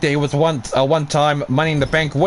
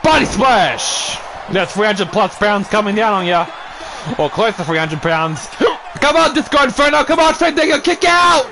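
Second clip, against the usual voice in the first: hyped wrestling commentary, higher and pushing; play-by-play call; 6 semitones higher than usual. Acted. Commentator urging Disco Inferno to fight back, excited play-by-play. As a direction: cheer the wrestlers on like a ringside announcer.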